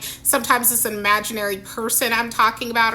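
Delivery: ordinary talk, nothing marked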